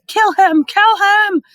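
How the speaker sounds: high, loud repeated yell. A narrator's reading. Voldemort yelling at Quirrell to kill, loud and high. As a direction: yell it twice, high and hard. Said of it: "Voldemort's yell of".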